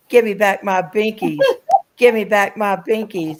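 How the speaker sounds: lightly voiced toddler; little girl demanding. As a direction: beg like a cross two-year-old, laughing.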